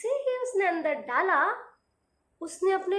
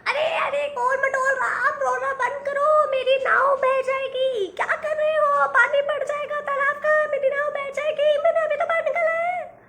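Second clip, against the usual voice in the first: much higher, louder yelling boatman; boatman shouting; 11 semitones higher, 7 dB louder than usual. Acted. Boatman yelling at crying Golmatol Ram to stop, high shout. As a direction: shout in alarm that your boat will drift away. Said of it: "जोर से चिल्लाया".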